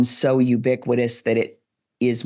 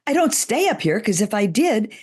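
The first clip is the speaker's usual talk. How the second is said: pitched up high to show strain; 8 semitones higher than usual. Shown as a demonstration. Voice coach demonstrating a strained, pitched-up voice. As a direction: push the voice up into the head. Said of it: "my voice would be strained".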